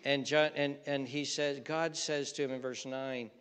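usual speaking voice

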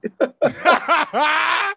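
high, loud burst of laughter; real laugh. Real, not acted. Comedian laughing at his own nickname joke, high cackle. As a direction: crack up at your own joke.

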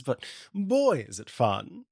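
usual speaking voice

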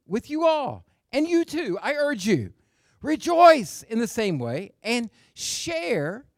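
high, slow, stretched-out declaiming; exultant scripture preaching. Acted. Preacher reading Paul's rejoicing to his church, slow and exultant. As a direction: ring out each phrase high, drawing out the key words.

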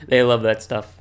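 normal talking voice

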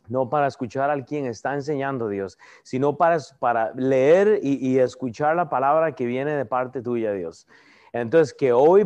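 a low, hushed prayer to God, unhurried; heartfelt prayer. Acted. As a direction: pray humbly, leaning into each plea. Pastor prays before the lesson.

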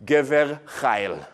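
Gever chayil with a throaty guttural ch, slow; Hebrew term. Shown as a demonstration. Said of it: "that's what it says in the text".

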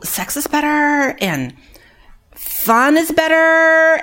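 hammed-up dreamy resident; imitating resident. Resident tells counselor drugs make everything better, mock dreamy.

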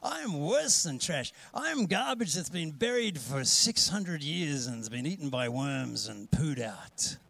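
drawn-out, woeful wallowing; hammed-up voice of someone who feels worthless; self-loathing inner voice. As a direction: play up the hopeless self-condemnation. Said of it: "do you want to listen to that voice".

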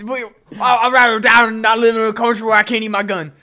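hammed-up rant for freedom to eat guns; mock patriot.

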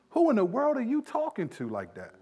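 high-pitched mock indignation; scolding parent. Parent challenging a child's back talk, indignant.

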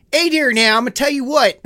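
loud, high mock Baltimore accent. Mimicking a failed Scottish read, loud Baltimore accent. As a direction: burst into a broad Baltimore accent. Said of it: "It turned into a Baltimore accent".

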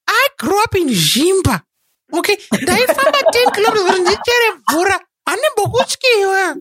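very high, hammed-up Zimbabwean accent. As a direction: lay down a mock challenge in Zimbabwean accent. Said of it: "facing Thanos with a Zim accent".

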